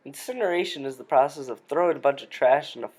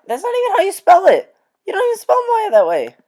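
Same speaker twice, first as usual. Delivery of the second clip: high, loud outraged yell; outraged shout; 8 dB louder, faster than usual. Real, not acted. Outraged rant about spelling.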